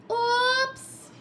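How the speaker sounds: a high, startled oops; surprised oops. A narrator's reading. Narrator gasping oops as Clark breaks the toy.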